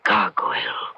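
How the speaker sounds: whisper. Acted. Frightened whisper at hideous knocker.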